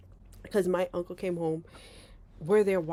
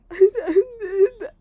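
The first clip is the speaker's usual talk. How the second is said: high, loud imitated sobbing; mock bawling; 13 semitones higher, 10 dB louder than usual. Terrified girl before her uncle, hammed-up bawling. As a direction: act out a child bawling hard. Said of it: "I'm like bawling snot".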